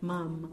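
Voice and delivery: mam as one closed syllable, no vowel after the final m; silent sheva. Shown as a demonstration. Teacher corrects the wrong reading, mam softly closed on the m. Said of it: "this is a silent sheba".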